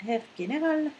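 German-style pronunciation of Herr General; German accent. Shown as a demonstration. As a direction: say the title the German way. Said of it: "à l'allemande, s'il vous plaît".

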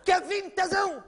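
sharp high shout; mother yelling at her son across the room. Acted. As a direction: call the boy to order loudly.

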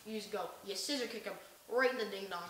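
ordinary talk

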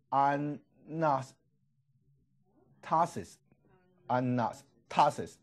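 anastasis spelled out in sound, every syllable separate; very slow demo. Shown as a demonstration. Teacher modelling resurrection in Greek, very slow. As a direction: crawl through anastasis for the class. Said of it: "那注意".

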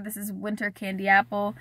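usual speaking voice

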